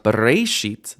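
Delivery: BERESHIT stressed on the middle RE; wrong middle stress. Shown as a demonstration. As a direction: punch the RE of bereshit. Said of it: "That's not correct".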